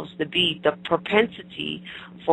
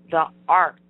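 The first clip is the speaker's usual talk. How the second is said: The Art, with Art pushed louder; stress on ART; 4 dB louder than usual. Shown as a demonstration. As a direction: lean hard on the word Art. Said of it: "and I emphasize the word art".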